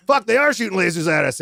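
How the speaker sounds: alarmed buddy confirming the lasers; panicked agreement. Retold or imitated. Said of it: "And he was like".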